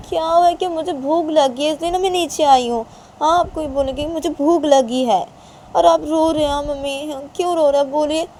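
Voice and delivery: high, loud, whiny insistence; girl complaining and demanding to know; insistent hungry daughter. Acted. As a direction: press mother for an answer, high and loud.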